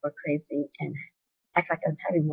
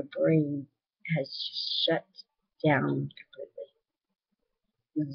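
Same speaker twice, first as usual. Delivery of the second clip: slowing to a halt; much slower than usual. Shown as a demonstration. Describing brain shutting down.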